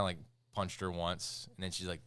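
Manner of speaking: normal talking voice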